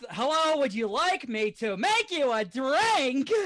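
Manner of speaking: barkeeper. Acted. Barkeeper offering a customer a drink, slow and hammed-up. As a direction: draw out the offer, hammed-up and high. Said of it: "And the other barkeeper?".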